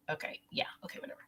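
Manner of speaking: real whispered aside to someone off camera. Real, not acted. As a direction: drop to a whisper and brush it off.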